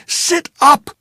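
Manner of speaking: high, loud, cursing yell; angry desperate shouting; furious shout. Acted. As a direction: bellow at her in panic. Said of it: "I shouted into her face".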